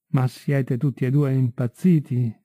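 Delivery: ordinary delivery, nothing marked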